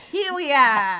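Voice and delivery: badly faked cartoon Australian; hammed-up mock Australian accent. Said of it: "I've done a bad accent".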